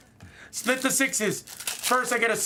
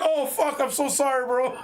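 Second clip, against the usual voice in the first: sudden high, flustered apology; startled apology; 6 semitones higher than usual. Retold or imitated. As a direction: blurt out a shocked sorry. Realizing he snubbed his friend.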